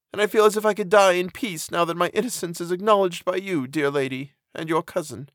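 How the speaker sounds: high, gentle, resigned female voice; meek prisoner finding peace; grateful condemned woman. A narrator's reading. Justine's grateful reply.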